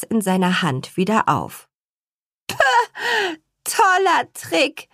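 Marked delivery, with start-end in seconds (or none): 2.5-4.9 put-on higher, slow scoffing girl's voice; scornful snort. Mona mocking the father's trick, slow high scorn. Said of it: "schnaubte Mona".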